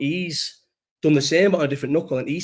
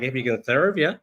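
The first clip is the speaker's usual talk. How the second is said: light mock American accent; imitating Americans, lightly put on. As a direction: quote them with a touch of American. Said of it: "in their American accent".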